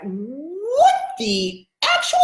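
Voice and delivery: high, very drawn-out incredulous reaction; acted shock, slow and high; stunned disbelief. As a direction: stretch each word out in disbelief. Retold shock at hearing dad's voice.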